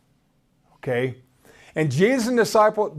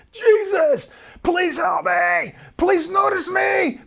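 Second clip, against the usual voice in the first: high, cracking cry for help; hoarse pleading mother; 10 semitones higher than usual. Acted. Hoarse mother crying out to Jesus in a high cracking voice. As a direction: cry out high with a voice worn raw. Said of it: "her voice was just cracking and hoarse".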